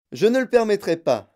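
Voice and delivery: plus aigu et fort; crié. Acted. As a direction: crier. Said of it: "cria monsieur Wonka". (Wonka crie à la mère)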